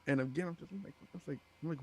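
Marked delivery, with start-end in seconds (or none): none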